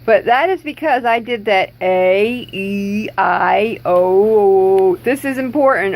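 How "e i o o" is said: "E, I, O" each stretched with wide mouth; exaggerated vowel exercise. Shown as a demonstration. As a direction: drill the vowels "E", "I", "O" slowly and wide. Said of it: "do those mouth exercises".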